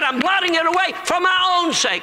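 high, exclaimed proclamation; fervent preaching. Acted. Preacher proclaims God blotting out sin, fervently. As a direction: cry it out high as the climax. Preacher climaxes on God's promise.

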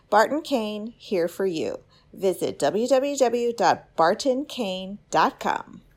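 lilting, scripted commercial read. Acted. Podcast host promoting a cane supplier to reed makers, ad read. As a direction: sell it like a friendly radio spot. Reads a sponsor ad.

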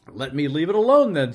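loud, rising irritation; exasperated retort. A narrator's reading. Scrooge answering his nephew's protest, exasperated. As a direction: shut the argument down loudly.

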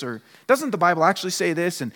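ordinary talk, nothing marked

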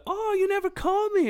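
very high mock complaint; hammed-up high-pitched chiding; imitated woman complaining. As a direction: mimic her reproach in a high voice.

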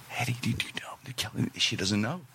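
slow hushed whisper. Retold or imitated. Husband whispering to wife in crowded trailer, hushed whisper. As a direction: whisper it so nobody hears.

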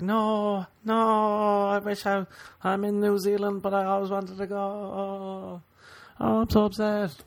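high, whiny fake distress; mock upset fan. Mocking a fan upset over a cancelled show, high whine.

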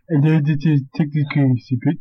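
copying a put-on Indian accent; mimicked Indian accent. Host mimicking friend's demo, imitated Indian accent. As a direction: parrot the greeting in a rough Indian accent. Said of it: "and I'll mimic it".

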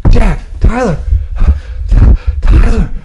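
a hint of Kate calling out to Jack; playing Kate. Friend playing Kate calls to Jack, lightly voiced. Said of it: "I'll be Kate".

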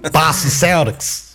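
put-on slow, failed Boston accent; attempted Boston accent. Guest copies the host's regional try, poor Boston accent. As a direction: have a halting go at a Boston accent. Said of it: "I can't even do that one".

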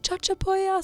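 high, drawn-out retold call. Yelling her destination to him from the bus, high calling out. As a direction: shout the destination to someone outside. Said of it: "og så ropte jeg bare sånn".

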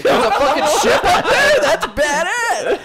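high, loud, slow, laughing amazement; excited disbelief. Retold or imitated. Friend replaying his own dumb excitement about the ship. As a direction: gush like it's the coolest thing ever. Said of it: "And then I was like".

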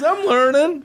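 high, goofy comic voice; blithe young pilot. Acted. Young pilot reassuring a passenger, blithely clueless. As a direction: chirp it like a clueless trainee.

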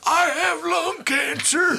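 high, slow cartoon shout; prospector voice. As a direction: rasp it out like an old prospector. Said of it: "I sound like a cartoon".

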